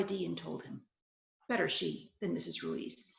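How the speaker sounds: steady reading-aloud voice for a crime story; story narration. A narrator's reading. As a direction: read it like a hard-boiled tale to an audience.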